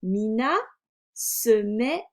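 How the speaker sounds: very slow, word-by-word reading; slow reading aloud. A narrator's reading. Teacher models reading for a beginning reader, slow word-by-word. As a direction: read deliberately with gaps between words.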